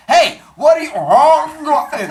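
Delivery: high, loud acted outburst; scolding shout. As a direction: yell at noisy kids to stop. Father Sky scolds noisy children, then retches.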